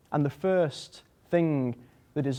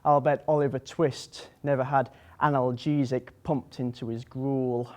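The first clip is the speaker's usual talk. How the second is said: ordinary delivery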